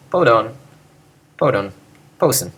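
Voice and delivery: podon, podon, posen tossed off low and unstressed; no accent. Shown as a demonstration. Teacher showing nothing to accent, podon and posen unstressed. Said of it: "don't accent it".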